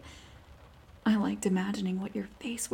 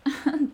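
teasing laugh; 6 semitones higher, 8 dB louder than usual. Acted.

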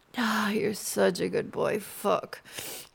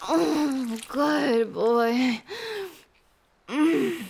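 climax cries; 4 semitones higher, slower than usual. Acted. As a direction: let it break out in rising cries.

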